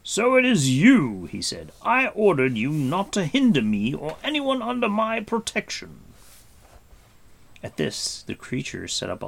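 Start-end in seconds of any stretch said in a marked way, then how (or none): 0.0-1.3 slow, stern and much louder, pitched high; severe, annoyed rebuke; stern annoyance. A narrator's reading. Gwydion rebuking the pestering creature, stern and loud. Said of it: "with a look of severity and annoyance". 1.8-6.0 high, loud stern reprimand; stern rebuke. A narrator's reading. Gwydion scolding Gurgi for hindering them, stern and loud. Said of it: "with a look of severity and annoyance".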